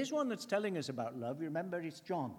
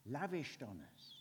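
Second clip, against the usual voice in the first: savoured softly; 6 dB quieter than usual. Shown as a demonstration. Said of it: "it's a lovely word, isn't it?".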